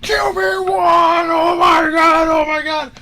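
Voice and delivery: screaming high and loud in joy; explosive shout. Retold or imitated. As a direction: explode with excitement. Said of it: "we all just explode".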